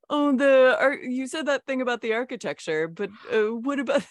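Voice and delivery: a hint of a know-it-all on a tour; annoying tourist. Imitating an annoying tour guest asking questions, mild mock voice. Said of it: "someone on a tour that's like".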